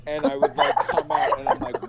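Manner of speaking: usual speaking voice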